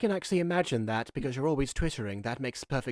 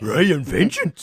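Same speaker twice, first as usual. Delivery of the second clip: dramatic villain voice; 2 semitones higher, 11 dB louder, much slower than usual. Pretend. Dramatic villain name said slowly.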